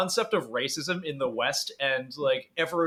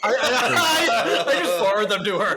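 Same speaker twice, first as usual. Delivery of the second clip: louder, higher through laughter; laughing; 5 semitones higher, 4 dB louder than usual. Real, not acted. Friends recounting meme pranks, laughing loudly. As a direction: barely get words out for laughing. Both laughing about memes.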